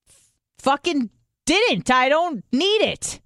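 high, drawn-out retort; exasperated defensiveness. Retold or imitated. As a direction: push back slowly in mock exasperation. Retold defensive answer to nurse.

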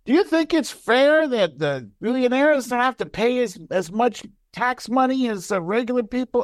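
imitated high-pitched speech to an audience; rallying the crowd. Retelling the president asking the audience, high rallying call. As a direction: put the question out to a whole crowd. Acting out Biden's call and response.

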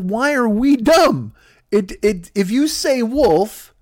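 high, slow, drawn-out indignation; exasperated family. Acted. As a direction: spell it out for an idiot. Family argues with George.

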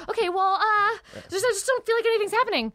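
high-pitched, hurried fretting; mock restless worry. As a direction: fret high and fast, playing up the unease. Acting out restlessness during week off.

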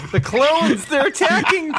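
very high, hammed-up panicked cry; mock alarm. Co-host parodying the film's battle warning, mock panic. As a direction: squeal it like a melodramatic warning. Hammed-up film line, dreading next episode.

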